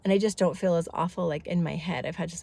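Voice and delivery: usual speaking voice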